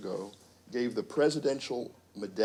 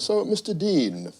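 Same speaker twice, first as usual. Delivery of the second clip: higher-pitched imitation English accent; British executive, English accent; 7 semitones higher than usual. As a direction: address him formally in a put-on English accent. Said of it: "with that English accent".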